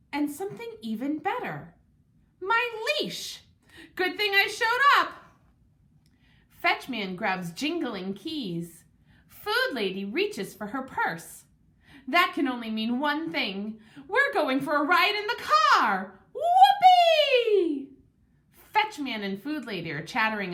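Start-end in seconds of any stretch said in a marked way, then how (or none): 2.4-5.1 high, loud burst of glee; thrilled. A narrator's reading. Dog thrilled to see leash. 14.1-17.9 very high, loud joyful cry with a laugh; ecstatic whoop. A narrator's reading. Dog ecstatic about car ride.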